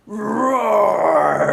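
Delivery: long loud high roar; big tiger roar. Shown as a demonstration. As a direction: let out a full wild roar. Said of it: "we can try and roar like Mister Tiger".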